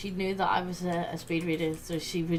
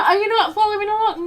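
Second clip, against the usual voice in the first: much higher and louder; scolding teacher; 12 semitones higher, 12 dB louder than usual. Retold or imitated. The teacher scolds the distracted pupil, high and loud. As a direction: ask it like a miffed teacher. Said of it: "And they're".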